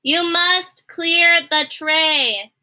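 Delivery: high, loud and very slow; shouting Cook. A narrator's reading. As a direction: bellow each word slowly. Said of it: "shouted Cook".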